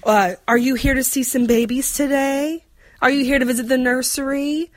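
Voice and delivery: high, sing-song Southern nurse greeting; Southern accent. Retold or imitated. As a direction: play a cheery Southern receptionist. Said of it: "also gonna do some Southern accents".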